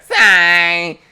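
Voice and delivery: loud, high creature cry; Psyduck impression. Fan mimicking Psyduck's cry, loud cartoon voice. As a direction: honk it out in a put-on voice.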